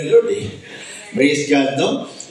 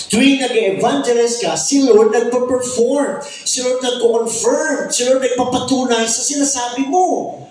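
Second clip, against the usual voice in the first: preaching at full force; 8 semitones higher than usual. Acted. Pastor urging the church to evangelize, fervent. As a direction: drive the point home like a revival preacher.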